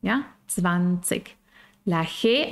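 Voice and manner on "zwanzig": "Zwanzig" ending in a crisp k, tsvan-tsik; final k. Shown as a demonstration. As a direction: close "Zwanzig" on a clean k. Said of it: "la k al final".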